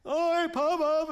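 put-on high, hesitant child's voice; a small girl nervously agreeing to go; little girl giving in. Granddaughter agreeing to slide down to grandpa, high and hesitant.

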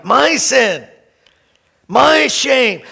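ordinary talk, nothing marked

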